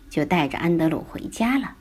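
usual speaking voice

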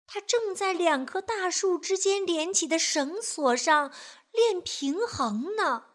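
ordinary delivery, nothing marked

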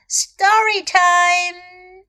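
high, drawn-out jolly call; loud, jolly call. A narrator's reading. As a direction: call out loudly and cheerfully, stretching the words. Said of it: "a loud, jolly voice called out". (欢乐地拖长声音大喊)

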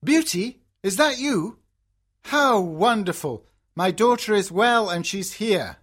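father's delighted exclamation; joyful cry. A narrator's reading. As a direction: exclaim it loudly in happy surprise. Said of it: "he cries".

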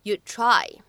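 "you'd try" run together, d unreleased; "you'd try" d held before the t. Shown as a demonstration. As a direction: blend "you'd try", d not exploded. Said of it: "应该读成you'd try".

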